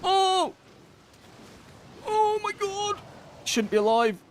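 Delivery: shocked squeal. Real, not acted. Gamer stunned he survived the hit, high shocked yelps. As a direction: yelp in disbelief at surviving. Narrowly surviving boss mechanic.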